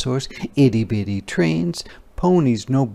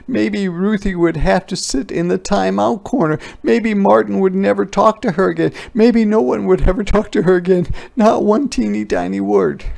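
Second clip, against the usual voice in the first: higher, faster, building panic; rising worry; 9 semitones higher, 5 dB louder, faster than usual. A narrator's reading. Narrator voices Ruthie's growing worry, faster and higher. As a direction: let the fear snowball. Ruthie's anxious thoughts spiral.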